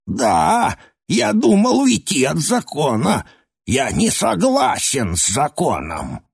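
put-on brazen, high creaky old man; defiant old man talking back; insolent. A narrator's reading. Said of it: "Староживущий нагло".

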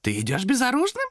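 high-pitched creature's question; surprised demon. A narrator's reading. Demon questions Томас leaving unarmed, surprised. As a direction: ask in astonishment as the demon. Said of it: "удивлённо спросил демон".

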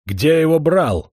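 высокий громкий медленный крик; выкрикни вопрос, давя на «брал». Acted. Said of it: "закричал Астратион". (князь кричит повторный вопрос: «Где его брал?» с ударом на «брал»)

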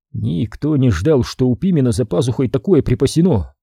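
ordinary delivery, nothing marked

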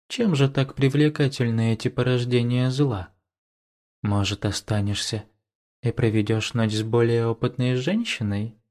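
put-on a touch of a languid older woman; sultry woman. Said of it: "томно протянула".

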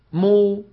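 مو released into a long rounded oo; meem with damma. Shown as a demonstration. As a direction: part the lips from m into a held oo on مو. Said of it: "انطق ميم مضمومة". (مو بميم مضمومة ممدودة)

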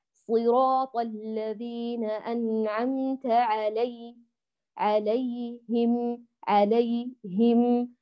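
slow recitation with "عليهم" broken by silence before him; "عليهم" split in two by a gap; cut-off yaa. Shown as a demonstration. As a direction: stop dead after the ay of "عليهم", then say him. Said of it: "يقطع، لا تسكتي بينهما".

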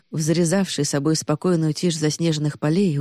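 plain reading voice

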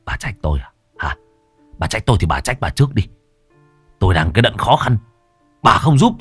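angry son. Acted. Son rebukes his mother, rising loud and fast. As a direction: throw the blame back at her.

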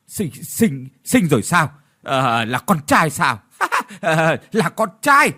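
stammering into gleeful laughter; excited stutter and laugh; stunned then delighted. Acted. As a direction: burst into joy with a laugh. Old man hears son born.

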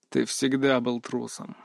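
quiet, slow scornful sneer; contemptuous. A narrator's reading. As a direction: sneer it with disgust. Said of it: "презрительно скривился Львов".

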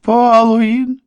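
высокий отчаянный вопль; вопи имя во весь голос. A narrator's reading. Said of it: "завопил Морган". (отчаянный высокий вопль)

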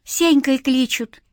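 put-on high, slow child's reply; small boy giving his name, drawn out. A narrator's reading. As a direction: answer like a little street kid. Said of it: "ответил мальчик".

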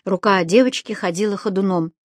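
usual speaking voice

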